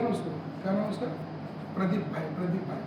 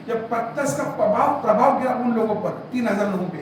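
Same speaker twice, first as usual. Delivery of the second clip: normal talking voice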